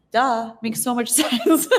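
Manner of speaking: slow amused duh with a laugh; mock-obvious, laughing through it. Host reacting to the guest's idea, amused and laughing. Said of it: "my first reaction is".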